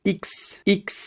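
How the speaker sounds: "ইক্স" said with a high "ই", not "এক্স"; French X. Shown as a demonstration. Teacher corrects students to "ইক্স". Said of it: "ইক্স, এক্স না".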